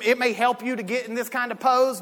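ordinary talk, nothing marked

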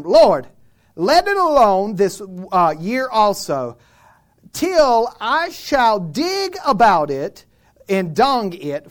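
loud scripture read in a preaching cadence; loud sermon reading. Acted. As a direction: proclaim the verses to the hall.

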